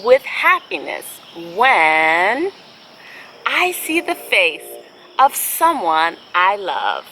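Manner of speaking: slow, gentle storybook reading; picture-book read-aloud with long pauses; reading to children. Acted.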